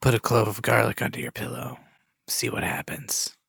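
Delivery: soft ominous whisper; cryptic whisper. Acted. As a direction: breathe it like a secret threat. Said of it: "it could be all cryptic".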